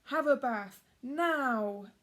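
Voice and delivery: loud, firm giant command; giant's order. Acted. Giant ordering stinky Jack to wash, loud. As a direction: insist like a fed-up giant.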